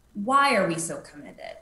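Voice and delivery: usual speaking voice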